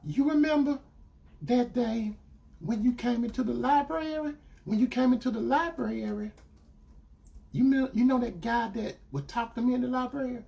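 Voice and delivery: retold girlfriend. Girlfriend reminding him of the library, imitated hesitantly. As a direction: imitate her gently leading up to a confession. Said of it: "she said".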